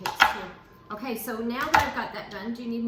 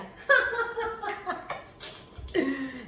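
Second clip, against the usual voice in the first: amused laugh at a pun; 8 semitones higher than usual. Real, not acted.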